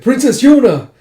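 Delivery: high, loud cry of a name; calling out. Acted. Rescuer hailing the princess, calling out.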